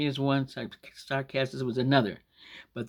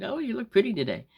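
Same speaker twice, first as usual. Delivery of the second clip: high-pitched fake gushing; sarcastic compliment; 9 semitones higher than usual. Telling someone who doesn't look nice, sarcastic. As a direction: feign admiration in a raised, sweet-talking pitch. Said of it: "But they don't mean it".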